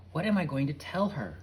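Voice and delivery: plain reading voice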